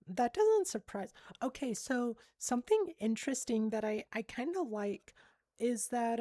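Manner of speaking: ordinary talk, nothing marked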